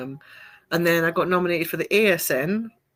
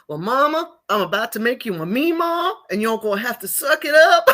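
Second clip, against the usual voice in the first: put-on very southern accent; 4 semitones higher, 5 dB louder than usual. Daughter teasing her mama on FaceTime, hammed-up southern accent. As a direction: play a sassy Southern daughter, pretend accent. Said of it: "a very southern accent together".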